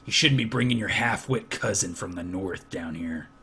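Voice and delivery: low, soft, scolding foreman voice; stern foreman. Acted. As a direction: scold him quietly from low in the chest.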